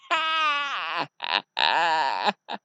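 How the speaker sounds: drawn-out wicked laughter; evil villain cackle. Acted. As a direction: cackle like a gloating dark lord. Villain laughs after naming apprentice.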